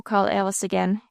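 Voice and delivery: ordinary delivery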